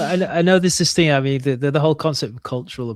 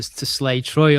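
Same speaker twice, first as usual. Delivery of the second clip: normal talking voice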